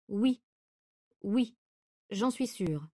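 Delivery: halting answer, with pauses between words; hesitant. A narrator's reading. Cousin answers after searching her memory, hesitant. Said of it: "Elle hésita quelques secondes".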